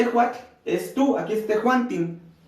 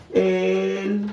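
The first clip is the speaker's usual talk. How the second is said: very slow, stretching each word; spaced out word by word, held long; drawn-out dictation; much slower than usual. Real, not acted. Teacher translating the example sentence for students, drawn-out dictation.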